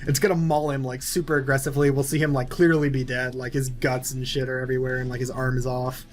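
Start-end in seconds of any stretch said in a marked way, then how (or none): none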